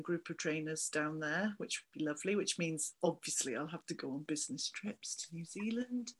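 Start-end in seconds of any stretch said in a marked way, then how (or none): 2.3-6.1 conspiratorial whisper aside. Real, not acted. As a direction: let the joke slip out in a whisper. Joking about business trips.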